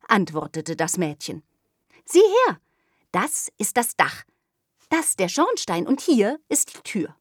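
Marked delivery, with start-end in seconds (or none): 2.1-7.2 put-on higher, louder eager child showing off; eager little girl. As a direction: proudly point out each part. Girl shows her drawing.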